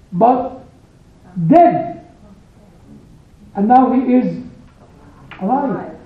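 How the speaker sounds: normal talking voice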